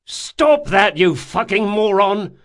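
high, loud furious shout; angry yell. A narrator's reading. Locke to the thrashing bandit, furious yell. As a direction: snap him out of kicking.

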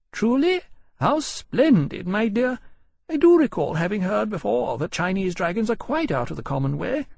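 high-pitched, gracious mother, admiring; impressed lady. Acted. Mother hears of her son's rare dragon, impressed. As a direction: admire it in a high, lively lady's voice.

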